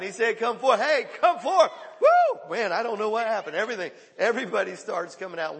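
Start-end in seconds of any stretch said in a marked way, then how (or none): 0.4-1.7 loud, high, ringing Come forth; booming command. Retold or imitated. Preacher as Jesus commanding Lazarus out of the tomb, booming. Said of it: "You know what happened". 2.0-2.5 high, loud preacher's whoop; excited whoop. Real, not acted. Preacher whooping in excitement at the congregation. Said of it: "excited whoop".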